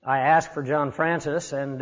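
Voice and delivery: normal talking voice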